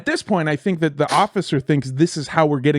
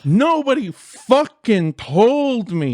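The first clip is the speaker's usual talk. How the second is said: slow, higher anguished protest; exasperated dismay; 5 semitones higher, slower than usual. Retold or imitated. Comic who missed the news, exasperated. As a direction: stretch out each word in dismay. Retelling his dismay, drawn out.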